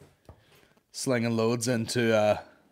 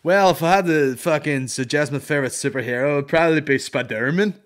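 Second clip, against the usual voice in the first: mock interview answer; 4 semitones higher, 8 dB louder than usual. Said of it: "I don't want my voice to be in it".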